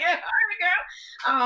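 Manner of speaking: a playful sassy high voice; high mock-sassy quip; sassy reply. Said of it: "And I'm like".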